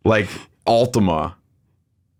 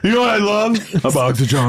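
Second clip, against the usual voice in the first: higher, half-laughing Forrest Gump voice; Forrest Gump impression; 7 semitones higher than usual. Joking along with the plane story, a light Forrest Gump. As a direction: start higher and loose, settle on the chocolate line. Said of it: "watching Forrest Gump".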